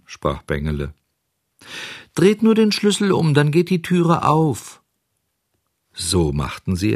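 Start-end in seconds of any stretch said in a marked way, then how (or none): 2.1-4.8 very high tiny creature; fine little voice. A narrator's reading. Cricket tells visitors to open up, fine voice. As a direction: pipe up in a small high voice. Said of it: "rief eine feine Stimme".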